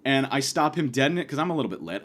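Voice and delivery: usual speaking voice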